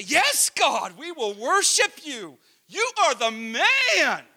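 high, loud, drawn-out cheer; hammed-up zealous pledge; gung-ho praise. Believers to God after something awesome, mock-exuberant praise. Acting out people's eager worship.

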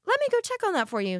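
very high, over-polite hostess voice; customer-service voice. Retold or imitated. Retold placating reply to angry customer.

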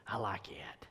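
whispered aside from a fan. Retold or imitated. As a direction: breathe it like a secret crush. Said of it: "Gentiles in the congregation that said".

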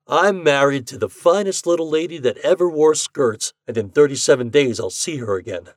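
a hint of a cocky bettor; Ashton voice. A narrator's reading. Ashton proud of wife.

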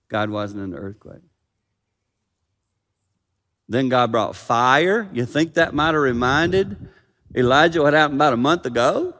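ordinary delivery

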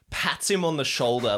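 usual speaking voice

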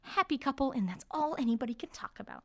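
high, hammed-up gushing gossip; mock gossip about the happy couple; gossipy swoon. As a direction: gush like the whole school is swooning. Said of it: "we get this, like, this".

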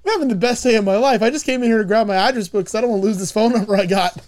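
fast, carefree rush; light mock glee; giddy fast quote. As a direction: rattle it off happily. [name]'s excited quote.

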